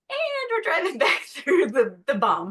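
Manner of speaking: laughing disbelief. Retold or imitated. Retold thought, laughing.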